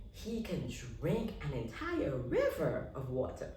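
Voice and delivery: usual speaking voice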